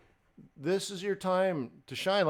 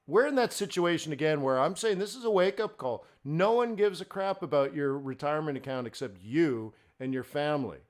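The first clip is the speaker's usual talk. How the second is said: ordinary talk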